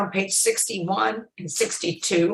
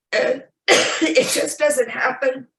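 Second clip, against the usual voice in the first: slow, higher, breaking on a sob; real tearful emotion; 6 semitones higher, 4 dB louder, slower than usual. Real, not acted. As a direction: force the words out through tears. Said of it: "excuse me for getting emotional".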